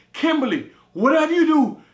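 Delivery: very loud, high warning; urgent shout. Retold or imitated. Warning friend as lion closes in, urgent. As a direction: shout a warning not to move. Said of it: "and I say".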